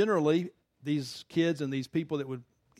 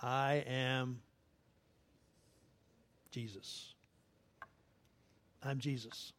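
hushed voice of Jesus; 2 semitones lower, 6 dB quieter, much slower than usual. Retold or imitated. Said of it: "the voice says to him clearly".

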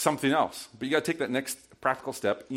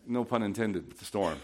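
normal talking voice

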